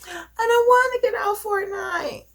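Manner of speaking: put-on high, whining little-girl refusal; whiny kid. Daughter refusing to leave Fortnite, high childish whine. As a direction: whine it like a kid who won't stop gaming. Daughter protests, mom voices her.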